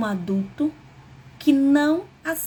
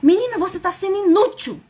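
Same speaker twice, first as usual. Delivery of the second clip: put-on loud, high, cutting scold of a child; harsh scolding; 8 semitones higher, 8 dB louder than usual. Parent belittling a little girl, loud harsh scold. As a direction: snap the insult at the girl. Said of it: "se eu falar pra uma criança".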